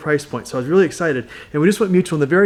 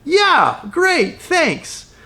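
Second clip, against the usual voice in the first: high, sing-song mock cheer; a pleased client, voiced much higher and brighter; mock upbeat client; 12 semitones higher, much slower than usual. Agent mimics client now happy, high mock cheer. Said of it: "And he was like".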